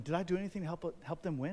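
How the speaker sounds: ordinary talk, nothing marked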